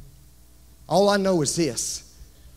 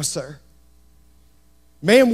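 ordinary talk, nothing marked

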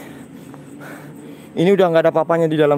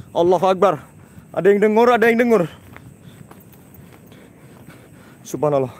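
spooked and excited; 5 semitones higher than usual. Real, not acted. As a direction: sound shaken, blurting it out to the viewers. Hearing ghostly laughter, spooked.

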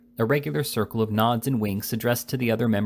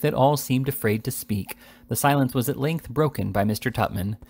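ordinary delivery, nothing marked

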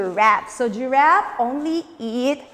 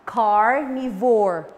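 carnivore slow, falling; model word; 5 semitones lower, slower than usual. Shown as a demonstration. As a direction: say carnivore slowly again. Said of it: "One more time".

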